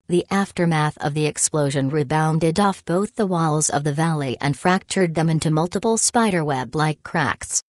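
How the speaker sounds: ordinary delivery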